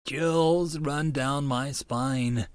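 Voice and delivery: ordinary delivery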